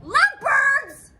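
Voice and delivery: incredulous. Acted. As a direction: echo it back in disbelief. Disbelief at lovebirds.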